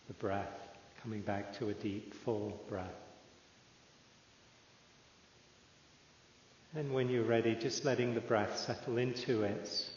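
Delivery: calm, unhurried meditation guide with long pauses; measured, settled voice leaving room to breathe; guided meditation. Real, not acted. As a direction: ease listeners into stillness, phrase by phrase.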